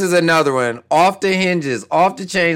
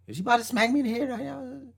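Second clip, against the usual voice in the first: nervous inner voice; 6 semitones higher, 10 dB quieter, faster than usual. Retold or imitated. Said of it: "I'm like".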